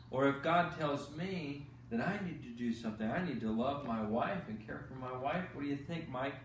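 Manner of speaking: plain reading voice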